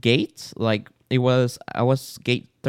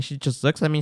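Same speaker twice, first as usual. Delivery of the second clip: ordinary delivery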